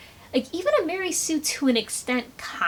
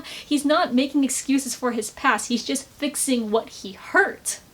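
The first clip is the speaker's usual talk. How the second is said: normal talking voice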